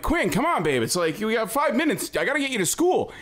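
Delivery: imitating a dad rushing his kid out; hurried dad pleading. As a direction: hurry a dawdling kid along. Dad hurrying child to school.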